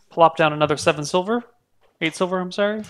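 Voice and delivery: ordinary talk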